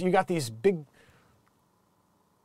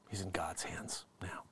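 soft mock-solemn whisper, much softer; 12 semitones lower, 20 dB quieter than usual. Vlogger mourning Igor, whispered. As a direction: hush it like at a funeral.

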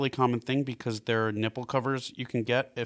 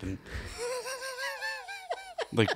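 ordinary delivery

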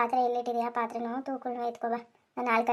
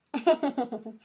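a short laugh at his fall; amused laugh. Acted. Wife laughing at her fallen husband, short laugh. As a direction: laugh at the sight of him. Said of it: "ಸುಮ್ನೆ ನಗ್ತಾ ನಿಂತಿದೀಯ".

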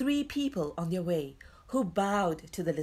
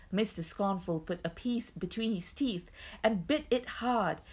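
usual speaking voice

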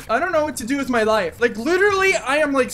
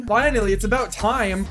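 ordinary talk

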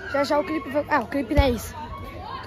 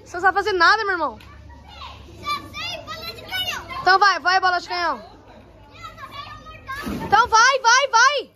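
yelling from the sidelines; 8 semitones higher than usual. Real, not acted. Cheering divers at pool.

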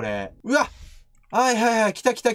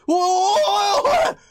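slow, high, loud scared wail; startled by the ghosts, a high, loud wail; scared yell; 12 semitones higher, 5 dB louder than usual. Real, not acted. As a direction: wail in fright as they close in.

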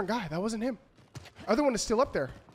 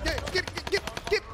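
higher, rapid urging; excited urging get get get; urgent get; 7 semitones higher, 4 dB quieter than usual. Real, not acted. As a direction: hurry it on, pitched up. Urging enemy forward.

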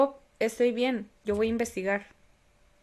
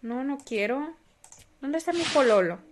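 ordinary delivery

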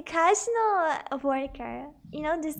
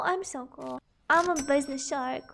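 usual speaking voice

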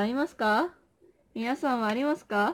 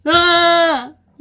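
sudden loud high shriek; shocked shriek; 6 semitones higher, 10 dB louder than usual. Real, not acted. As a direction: let out a startled scream. Shocked outburst on stream.

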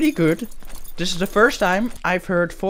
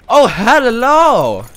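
drawn-out greeting; 5 semitones higher, 6 dB louder, much slower than usual. Acted. Greeting someone in the game.

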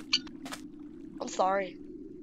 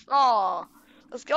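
ordinary delivery

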